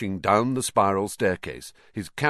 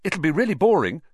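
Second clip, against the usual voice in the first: hasty, raised discouragement; quick; 9 semitones higher, 6 dB louder than usual. A narrator's reading. Harry tries to deter Colin from coming, quickly. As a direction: put him off quickly, louder. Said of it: "Harry said quickly".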